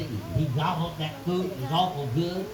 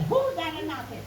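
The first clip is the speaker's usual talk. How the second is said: high woman's voice; 11 semitones higher than usual. Acted. Woman answers the door.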